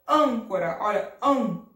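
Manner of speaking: Âncora with loud closed ân, then ân alone; closed nasal Â. Shown as a demonstration. Teacher isolating the sound, loud ân in Âncora. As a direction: punch the nasal first syllable of Âncora. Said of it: "É um som fechado".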